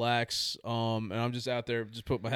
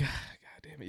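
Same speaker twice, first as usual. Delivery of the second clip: whispered curse; 14 dB quieter than usual. Retold or imitated. Caught out, muttered curse.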